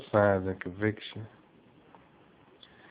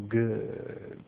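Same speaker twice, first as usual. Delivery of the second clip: under his breath; 8 dB quieter than usual. A narrator's reading. Hate to himself as World falls for it, under his breath. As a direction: say it softly to yourself. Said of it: "Under his breath".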